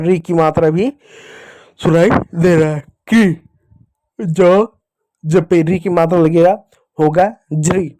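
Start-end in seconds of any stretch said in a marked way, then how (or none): 3.1-3.3 क्री with both "k" and "ri" heard; both sounds audible. Shown as a demonstration. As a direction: blend क्री as a clear "kri". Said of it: "क भी सुनाई दे रहा है". (क्री में क और री दोनों साफ़)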